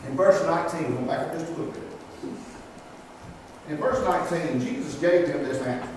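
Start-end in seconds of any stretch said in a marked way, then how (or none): none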